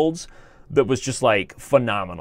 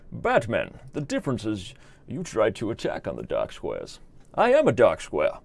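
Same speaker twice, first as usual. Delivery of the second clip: Bane; 3 semitones lower than usual. Acted. Villain schooling Batman on dark squares, theatrical menace. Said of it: "And Bane's like".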